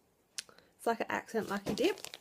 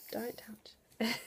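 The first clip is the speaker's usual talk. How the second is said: soft, breathy aside; whispered warning; 3 semitones higher, 6 dB quieter than usual. Real, not acted. Mum to her child off camera, whispered warning. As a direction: hush a quick warning under your breath.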